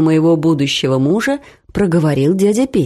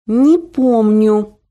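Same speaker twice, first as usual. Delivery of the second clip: slow, clenched "Не помню", each syllable squeezed out; through the teeth; 4 semitones higher, 3 dB louder, much slower than usual. A narrator's reading. Daughter losing patience with mother's stories, spat through teeth. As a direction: grind it out in irritation. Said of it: "процедила я".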